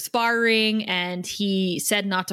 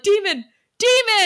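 high, loud frightened cry; panicked yell; 12 semitones higher, 6 dB louder than usual. Retold or imitated. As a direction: shriek in alarm. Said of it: "Xander is like".

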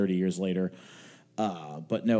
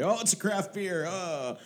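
high, swooning imitation of fans; mock beer-snob rapture; mock-impressed gushing; 8 semitones higher, 3 dB quieter than usual. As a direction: gush in a high voice, fading into a sigh. Mocking craft beer fans.

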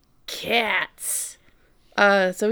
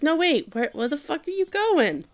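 a pitch-raised cat voice demanding to know where he's going; cat calling after owner, higher and indignant; mock cat protest; 7 semitones higher than usual. Cat to Subaru leaving, a higher indignant mock cat voice.

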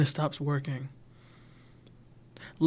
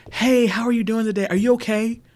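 higher, louder chipper check-in; acquaintance voice; 7 semitones higher, 5 dB louder than usual. Retold or imitated. Acquaintance casually greeting you, higher and louder chipper voice. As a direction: play a breezy acquaintance asking in passing. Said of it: "An acquaintance can tell you".

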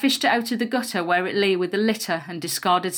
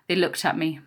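ordinary delivery, nothing marked

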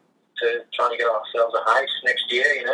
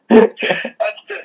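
laughing; 12 semitones higher, 8 dB louder than usual. Real, not acted. Cracking up at own impression.

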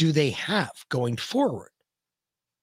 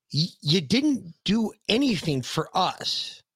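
higher, heated, incredulous questioning; exasperated rant; 8 semitones higher than usual. Real, not acted. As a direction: push each question up in disbelief.

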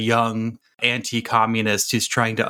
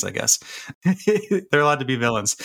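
usual speaking voice

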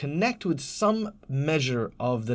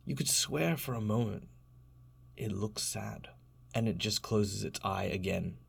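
hushed game master narration; 3 semitones lower than usual. Acted. Game master describing the sleeping creature to Trevor, hushed and suspenseful. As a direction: draw the players in with a hush.

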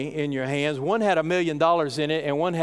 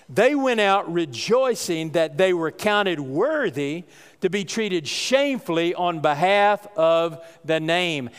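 slow scripture reading; slower than usual. Shown as a demonstration. Reading verse, slowed, sing-song.

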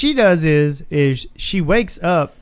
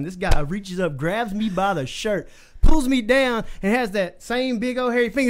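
usual speaking voice

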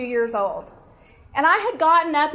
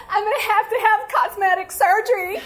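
high, whiny sobbed wail; exaggerated tearful whine; mock crying; 8 semitones higher, faster than usual. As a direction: blubber it out, hammed-up for laughs. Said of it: "he sees me crying".

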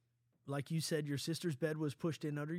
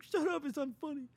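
much higher, sharp mock sister voice; Alicia annoyed; 12 semitones higher, 3 dB louder than usual. Sister scolding dad and daughter, high mock annoyance. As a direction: play the fed-up sister, pitched way up. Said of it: "Alicia's like".

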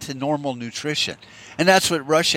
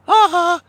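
mocking high laugh; 5 dB louder than usual. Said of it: "it's like".

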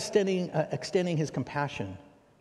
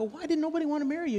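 high, fast, louder disbelief; incredulous quick question; 5 dB louder, faster than usual. Retold or imitated. Preacher pressing the man, high incredulous. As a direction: rattle it off in astonishment.

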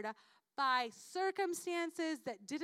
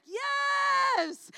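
excited shout; 11 semitones higher, 10 dB louder than usual. Acted. Eager reply to watchman prayer.

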